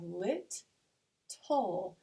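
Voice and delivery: "lit" left hanging, then "tull" falling; two separate beats, lit and tull; split into syllables. Shown as a demonstration. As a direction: sound out lit... tull for learners. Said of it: "as our final syllable".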